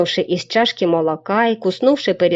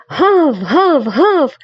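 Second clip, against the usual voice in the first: dog barking; 7 semitones higher, 4 dB louder, much slower than usual. Acted. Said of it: "басовито вторив Бровко".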